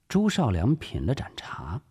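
plain reading voice

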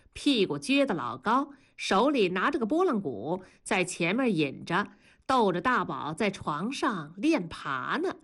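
ordinary delivery, nothing marked

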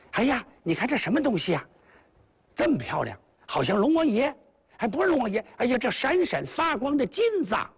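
high, excited bait cries; mock amazement. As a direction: fake wonder to lure the passer-by. 粉蜜桃假装井里有宝. (高声假装惊叹)

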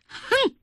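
high, short "hmph"; indignant huff. Acted. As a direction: huff in offence, pitched high. Queen's angry huff at servant.

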